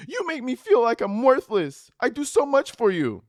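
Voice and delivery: shrill mock girlfriend. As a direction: wail it like an overwrought girlfriend. Her escalating text.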